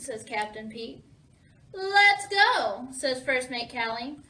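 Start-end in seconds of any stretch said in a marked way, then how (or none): 1.7-2.9 higher, louder rallying cry; eager rallying. A narrator's reading. First mate setting out for Secret Island, eager and loud. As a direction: urge the crew off to adventure.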